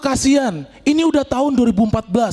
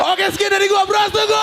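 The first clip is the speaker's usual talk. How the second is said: excited sign-off; 11 semitones higher than usual. Real, not acted. Comic signing off.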